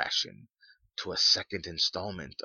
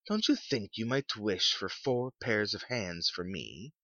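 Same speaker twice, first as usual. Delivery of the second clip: higher-pitched woman's playful question; teasing wife; 5 semitones higher than usual. A narrator's reading. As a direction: tease the husband with a lilting question. Voices the wife joking.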